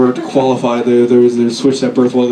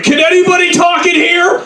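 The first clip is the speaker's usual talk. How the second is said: high shouting cop; imitating a baffled cop, shouting high; confused cop; 14 semitones higher than usual. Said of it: "He's like".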